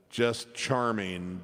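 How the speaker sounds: normal talking voice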